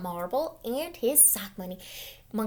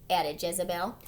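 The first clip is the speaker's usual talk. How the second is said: usual speaking voice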